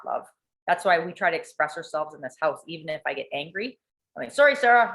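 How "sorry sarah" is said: higher, louder apology; blurted mock apology. Mother blurting an apology to her daughter, higher and louder. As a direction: call out a quick apology, higher.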